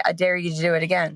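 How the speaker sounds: loud, firm mom dare; glaring dare. Shown as a demonstration. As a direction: stare them down as you say it. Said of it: "I just glare and say".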